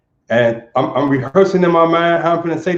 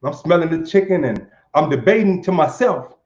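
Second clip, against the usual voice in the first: normal talking voice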